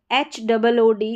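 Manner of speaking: ordinary delivery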